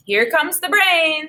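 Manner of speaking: drawn-out schoolyard tease, lightly voiced; teasing classmates. A narrator's reading. Narrating classmates teasing Shirley in the hall, slow sing-song. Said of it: "students would say when she passed by".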